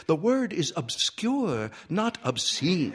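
high-pitched, cross correction; prim lady correcting him testily. A narrator's reading. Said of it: "Miss Sigsbee said crossly".

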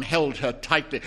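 usual speaking voice

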